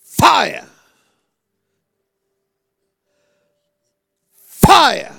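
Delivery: loud, high cry; shouting fire. Real, not acted. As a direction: call down fire full force. Prayer call for fire.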